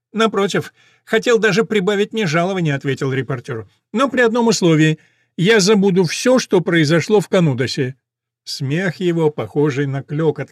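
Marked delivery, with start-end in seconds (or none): 0.1-2.7 high, lightly nasal reporter voice; a thin, reedy old reporter. A narrator's reading. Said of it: "тонкий гнусавый голос". 3.9-8.0 high, lightly nasal reporter voice; a thin, reedy old reporter. A narrator's reading. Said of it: "тонкий гнусавый голос".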